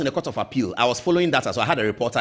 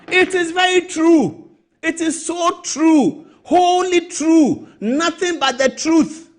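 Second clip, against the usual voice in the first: much higher, louder, very slow and deliberate; emphatic insistence; 14 semitones higher, 5 dB louder, much slower than usual. Real, not acted. Host insists to listeners it is true, slow and emphatic. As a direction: hammer each word home, slowly.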